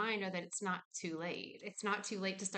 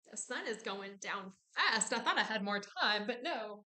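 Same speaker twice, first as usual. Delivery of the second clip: normal talking voice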